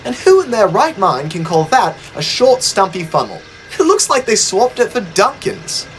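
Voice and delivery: high, indignant little engine; huffy, offended and chattering; indignant Thomas. A narrator's reading. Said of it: "Thomas looked indignant".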